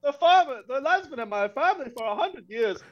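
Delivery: high-pitched, badly faked Irish accent; mock Irish accent. Farmer defending the family's land, high mock Irish accent.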